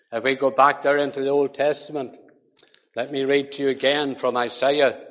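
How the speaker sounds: ordinary talk, nothing marked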